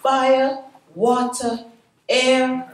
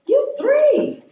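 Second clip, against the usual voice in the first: amused disbelief; 5 dB louder than usual. Retold or imitated. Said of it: "he said".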